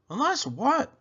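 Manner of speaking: put-on high curious boy's question; eager boy. A narrator's reading. Willie eagerly asking.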